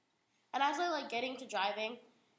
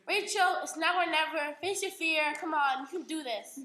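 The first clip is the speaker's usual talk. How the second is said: lightly voiced parent, loud and higher; parent pep talk; 5 semitones higher, 7 dB louder than usual. Dad pushing his scared daughter to drive, mock pep talk. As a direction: urge them on like a coaching parent. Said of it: "The parent talk".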